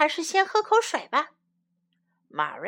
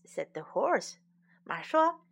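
plain reading voice

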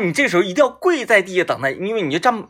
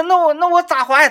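mock helpless; 13 semitones higher, 5 dB louder than usual. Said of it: "他说".

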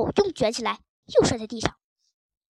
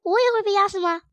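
alarmed, higher and louder question; very nervous; 6 semitones higher, 5 dB louder, faster than usual. A narrator's reading. Frightened dog asks whether he will be crushed too, nervous. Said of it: "地包天紧张极了".

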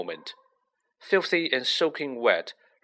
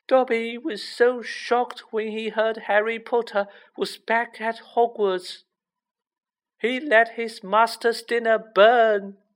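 lamenting house elf; 11 semitones higher, 3 dB louder than usual. A narrator's reading. House elf recounting his shock and flogging, lamenting.

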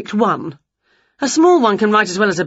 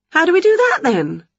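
much higher, louder witch; eager witch; 11 semitones higher, 4 dB louder than usual. Acted. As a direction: ask brightly. Winnie asks.

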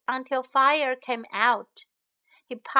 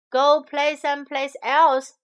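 raised, higher mock yell; storybook yell; 4 semitones higher, 7 dB louder than usual. Papa Bear sending visitors away, mild yell. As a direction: yell it lightly, as a cross papa. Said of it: "yelled Papa Bear".

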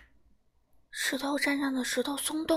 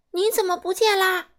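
higher, loud alarmed roar; 7 semitones higher, 7 dB louder than usual. Acted. Dinosaur dad roaring for his missing baby, loud and alarmed. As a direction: bellow in alarm for the missing child. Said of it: "霸王龙大声吼道".

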